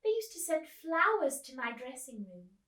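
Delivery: put-on grand old actress reminiscing. Miss Spink voiced in story.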